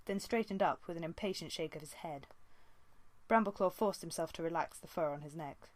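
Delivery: usual speaking voice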